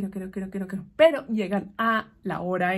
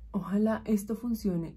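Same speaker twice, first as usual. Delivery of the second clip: whisper; 6 dB quieter than usual. Real, not acted. Nervous aside while painting makeup.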